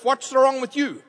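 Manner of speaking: ordinary talk, nothing marked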